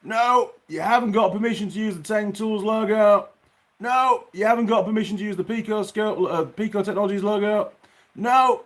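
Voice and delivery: high, loud, jobsworth refusal voice; mocking the company. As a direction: parrot the company's stubborn no, sneering. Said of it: "And they're like".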